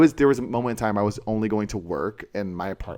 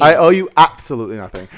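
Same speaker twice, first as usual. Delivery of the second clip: sassy declaration; 5 semitones higher, 10 dB louder, faster than usual. Acted.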